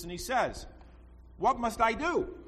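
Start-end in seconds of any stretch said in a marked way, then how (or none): 1.4-2.4 higher, pleading question; earnest plea. Retold or imitated. Rich young man asks Jesus, higher and pleading. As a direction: ask it up high, eager to learn.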